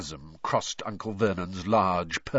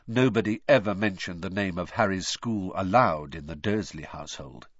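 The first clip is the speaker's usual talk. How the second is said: plain reading voice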